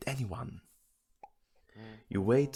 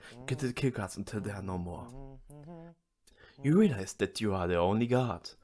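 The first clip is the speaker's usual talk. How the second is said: usual speaking voice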